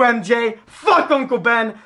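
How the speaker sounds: ordinary delivery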